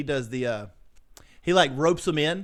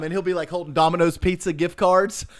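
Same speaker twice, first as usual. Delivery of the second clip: ordinary talk, nothing marked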